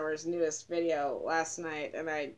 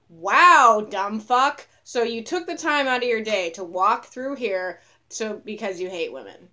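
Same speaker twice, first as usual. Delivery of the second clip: loud, higher, sarcastic dressing-down; scornful outburst; 4 semitones higher, 12 dB louder than usual. Pretend. Woman addressing the absent man who hates women, loudly scornful. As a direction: lay into him with mock amazement.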